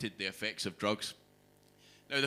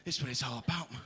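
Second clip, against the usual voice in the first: hyped speed actor. Retold or imitated. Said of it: "storming about the stage going".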